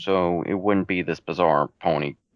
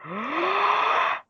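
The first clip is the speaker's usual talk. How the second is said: big gasp. Real, not acted. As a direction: suck in a huge breath. Gasp before the list of names.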